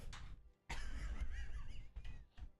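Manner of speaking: long breathy burst of laughter; real incredulous laugh. Real, not acted. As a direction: laugh hard at your own bad luck. Laughing about own live ban.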